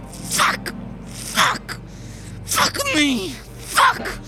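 hissed, then high strangled curses; panicked curses under breath; frustrated cursing. A narrator's reading. Man panicking about consequences.

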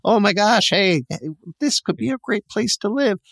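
high, squeaky wimpy guy voice; squeamish dude. Retold or imitated. Husband squealing at the sight of blood, high mock wimp. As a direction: play the fainting wimp, way up high. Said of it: "he's that dude".

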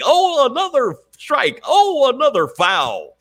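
high, slow announcer call; mock sportscaster. Mocking pundits as baseball announcer: high, slow call. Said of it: "people were trying to say".

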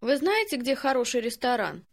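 usual speaking voice

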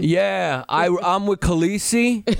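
high, drawn-out Long Island guy; mock a Long Island actor in a fantasy show; hammed-up Long Island accent, slow. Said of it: "you sound like you're from Long Island".

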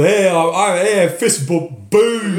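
loud, high, brash mock Cockney Iron Patriot. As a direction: send up a cosplayer's bad Cockney. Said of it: "Don Cheadle doesn't sound like that".

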